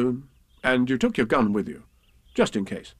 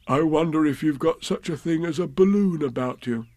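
higher, casually wondering bear; lightly voiced bear, hopeful; 4 semitones higher than usual. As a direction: ask as a bear hinting politely.

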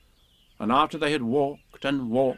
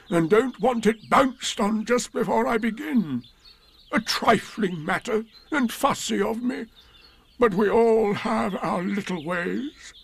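much higher, slow, put-upon donkey voice; fussy Eeyore; 10 semitones higher than usual. A narrator's reading. Eeyore asks Pooh to move Tigger, slow and fussy. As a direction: complain politely like a fussy old donkey.

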